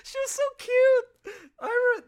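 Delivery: loud, high, laughing squeal; real gushing laughter. Real, not acted. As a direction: gush slowly through laughter. Laughing, gushing over actor.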